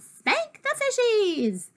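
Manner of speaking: high, slow, drawn-out call; sing-out mock pirate order; echoed pirate command. A narrator's reading. Violet echoes the pirate phrase.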